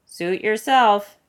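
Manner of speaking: loud, slow, pleased shrug; smug dismissal. A narrator's reading. Aramis brushing off Athos, cozy and content. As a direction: let it go smugly.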